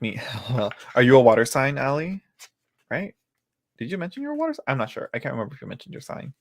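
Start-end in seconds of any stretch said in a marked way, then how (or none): none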